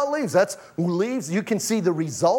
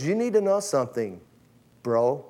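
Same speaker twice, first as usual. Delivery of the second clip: solemn Jesus; 5 semitones lower than usual. Acted. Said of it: "And he goes".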